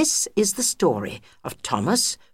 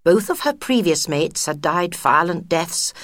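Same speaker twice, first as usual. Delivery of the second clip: usual speaking voice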